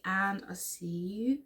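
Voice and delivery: usual speaking voice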